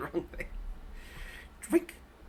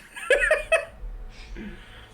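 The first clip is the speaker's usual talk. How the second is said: wheezing high-pitched laughter; high breathless laugh. Real, not acted. Laughing at her own advice to her friend, helplessly.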